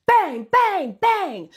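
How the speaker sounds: shouted high bangs imitating pounding; loud banging. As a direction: bang it out loud and high like a fist on a door. Retold loud banging on closet door.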